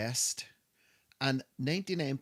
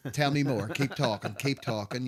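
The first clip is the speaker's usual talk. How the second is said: laughing, louder mock encouragement; sarcastic; 4 semitones higher, 7 dB louder than usual. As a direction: egg them on with playful sarcasm. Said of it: "that's my level of sarcasm".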